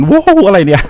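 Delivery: loud, sudden yelp of surprise; real shock, voice jumping loud; startled outburst. Real, not acted. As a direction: get caught off guard mid sign-off. Sudden surprise while signing off.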